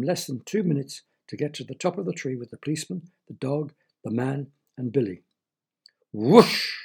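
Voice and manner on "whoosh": loud imitated gust of wind; wind whoosh. A narrator's reading. Storyteller imitating wind hitting the fireman, whooshing.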